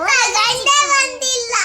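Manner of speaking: slow wail of real upset; upset, near tears. Real, not acted. Child complaining to mother that it hasn't come, tearful. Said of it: "ಅಳ್ಬೇಡ, ಅಳ್ಬೇಡ".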